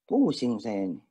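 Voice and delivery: whiny prayer. Retold or imitated. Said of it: "doa juga kayak begitu".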